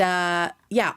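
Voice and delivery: ordinary talk, nothing marked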